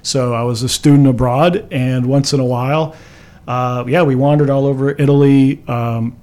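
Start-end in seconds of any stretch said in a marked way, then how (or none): none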